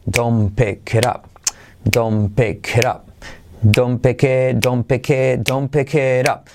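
"don't pick it up" repeated in even beats; drilled, beat-by-beat "don't pick it up"; rhythmic drill. Shown as a demonstration. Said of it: "Repítelo con ritmo".

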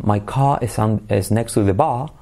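British non-rhotic "bar", ending on an open ah; British accent without R. Shown as a demonstration. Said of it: "en Inglaterra no pronuncian la R, dicen bar".

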